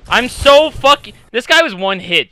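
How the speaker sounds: loud sarcastic ranting; frustrated shout. Real, not acted. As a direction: yell it, pretending not to care. Furious after dying.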